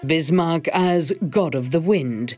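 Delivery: normal talking voice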